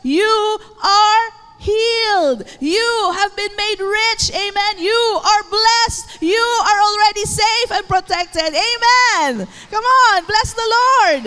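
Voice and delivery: loud, high, ringing proclamation; full-force preaching. Real, not acted. Preacher proclaims God's word to the congregation, impassioned and loud. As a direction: proclaim each promise with mounting force.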